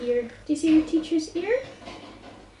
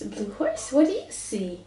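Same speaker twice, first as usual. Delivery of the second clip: usual speaking voice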